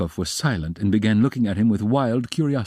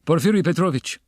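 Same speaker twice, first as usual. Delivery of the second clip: higher, carefully distinct address; raised, emphatic name; loud and distinct; 10 semitones higher, 4 dB louder than usual. A narrator's reading. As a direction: call the name out firmly and clearly. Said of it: "he began, speaking loudly and distinctly".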